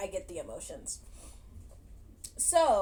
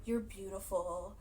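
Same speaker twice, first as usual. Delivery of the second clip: tender reassurance; 3 semitones lower, 7 dB quieter than usual. Retold or imitated. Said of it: "he's like".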